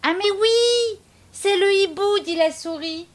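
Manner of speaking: high, loud sing-song call; cheeky mouse shouting. Acted. Mouse calling to the owl, high and loud. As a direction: hoot up at the owl.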